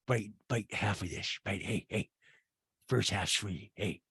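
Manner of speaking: low, soft pusher whisper-talk; mock drug dealer offering an edible; hushed dealer voice. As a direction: murmur it low like selling on a corner. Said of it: "Rinna to Sheree goes".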